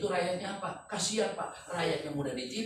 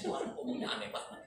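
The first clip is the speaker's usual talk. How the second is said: ordinary talk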